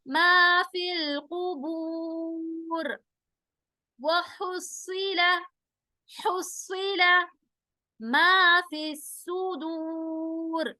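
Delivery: slow drawn-out tajweed chant; slow Quranic recitation. Shown as a demonstration. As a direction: recite each syllable slowly, melodically. Recites verse.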